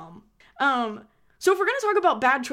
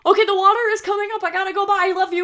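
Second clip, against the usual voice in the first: rushed goodbye; 6 semitones higher, 6 dB louder, faster than usual. Pretend. Hurried goodbye call while flooding.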